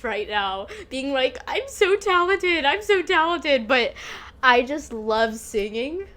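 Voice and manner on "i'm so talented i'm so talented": higher-pitched self-mocking boast; mock egotistical. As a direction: parody yourself bragging, pitched up. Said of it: "sound like an egotistical ass bitch".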